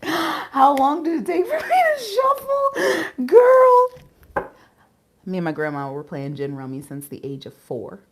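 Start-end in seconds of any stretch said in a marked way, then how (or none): 0.0-3.9 high, loud squeal through laughter; cracking up, voice shooting way up; laughing exclamation. Real, not acted. Exclaiming amid laughter over shuffling.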